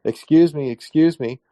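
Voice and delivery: calling out to interrupt. Retold or imitated. Man at a UFO conference interrupting the presenter, loud. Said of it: "and I said".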